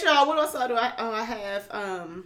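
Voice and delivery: normal talking voice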